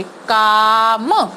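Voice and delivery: काम stretched slowly; slow sounded-out word. Shown as a demonstration. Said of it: "माँ का sound slow बोलेंगे". (काम को धीरे-धीरे बोलना)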